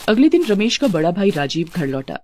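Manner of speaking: ordinary delivery, nothing marked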